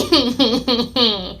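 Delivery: put-on villain snicker; mock wicked giggle; mean laugh. Narrator acting out Snoop laughing meanly, put-on cackle. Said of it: "Snoop laughed meanly".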